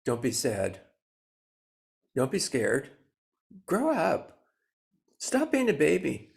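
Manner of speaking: scolding, rising to a loud high snap; harsh inner critic. Retold or imitated. Inner critic scolding the sad self, loud and harsh. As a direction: scold like an impatient parent. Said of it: "you might hear yourself saying".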